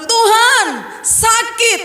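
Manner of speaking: high, aching cry to God; pained plea to God. Retold or imitated. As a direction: plead with God in pain. Voiced cry of pain to God. (seruan pilu yang tinggi)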